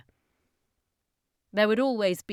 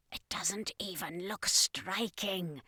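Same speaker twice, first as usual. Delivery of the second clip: insisting alien; 6 dB quieter, slower than usual. Acted. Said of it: "the second in command insisted".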